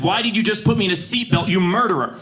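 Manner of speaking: high, indignant accusation; mock outrage. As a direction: accuse her, hammed-up.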